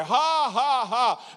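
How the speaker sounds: high spoken ha-ha-ha; deliberate forced laugh. Retold or imitated. Preacher as sick man lying in bed, high forced laugh. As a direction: imitate laughing on purpose. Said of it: "But he laid there".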